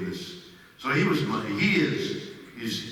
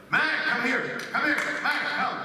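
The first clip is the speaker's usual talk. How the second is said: high, urgent dog call; 14 semitones higher, 3 dB louder than usual. Retold or imitated. Owner calling the dog in a hurry, high and urgent.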